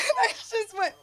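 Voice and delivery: high squeaky laugh. Real, not acted.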